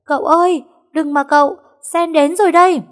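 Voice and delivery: high, loud cry across the bridge; desperate shout. A narrator's reading. Maid shouting to her master on the bridge, high and loud. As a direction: yell to stop him from jumping. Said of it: "Tiếng hét của nó như muốn rung cả trời đất".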